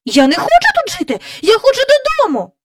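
fast, high-pitched desperate outburst; despairing child's cry. Acted. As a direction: plead desperately, pitch shooting up. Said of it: "розпачливо скрикнула Джейн".

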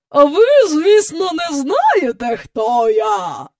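high, haughty voice falling at the end; grand lady announcing herself; imperious visitor. A narrator's reading. Imperious visitor presenting herself to the family, haughty.

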